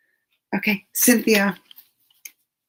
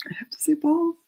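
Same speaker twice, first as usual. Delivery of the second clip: whisper; 11 semitones higher, faster than usual. Real, not acted. Embarrassed whisper then laugh.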